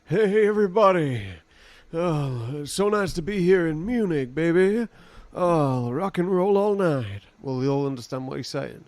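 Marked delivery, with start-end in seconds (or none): 0.0-7.2 a brash rock star hailing the crowd; rock star stage greeting. Retold or imitated. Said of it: "he's like".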